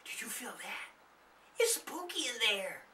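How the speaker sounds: nervous gulp. A narrator's reading. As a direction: swallow your fear. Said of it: "Darlene gulped".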